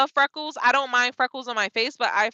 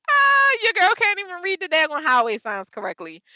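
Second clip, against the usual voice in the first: self-mocking shout; 6 semitones higher than usual. Real, not acted. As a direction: yell it at yourself in mock disgust. Laughing at her own mistake.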